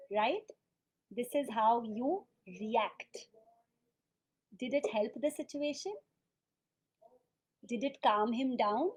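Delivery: ordinary talk, nothing marked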